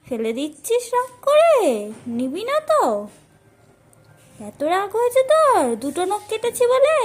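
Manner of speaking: high and loud, fond disbelief at the bird; high, loud pet scolding. Real, not acted. Bird refuses food after nail trim.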